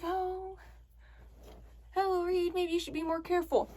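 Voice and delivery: high, soft polite caution; timid warning. Retold or imitated. Voices own timid warning to driver.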